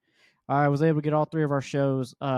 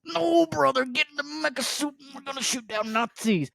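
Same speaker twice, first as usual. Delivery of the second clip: high, theatrical hello brother; much higher put-on character voice; 10 semitones higher than usual. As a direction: ham it up like a costumed hero. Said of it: "don't be in character".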